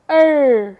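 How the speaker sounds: R said alone, loud and drawn; R sound demo. Shown as a demonstration. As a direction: sound out the R on its own, loudly. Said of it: "आर control sound". (अकेली R की आवाज़ ज़ोर से)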